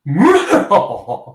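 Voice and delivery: high evil chuckle; villain laugh. Pretend. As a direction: cackle like a villain. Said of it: "This is my villain laugh".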